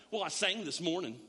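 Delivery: usual speaking voice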